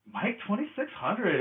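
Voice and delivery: high, soft, slow camp voice; mock camp drop. Imitating a joke battle drop, high slow camp voice. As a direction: draw the name out high and softly.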